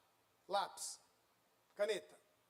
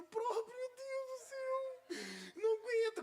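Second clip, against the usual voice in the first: high, tearful cry to God; sobbing prayer, slow and high; crying plea; 14 semitones higher, slower than usual. Real, not acted. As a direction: voice breaking as he pleads. Emotional plea during preaching.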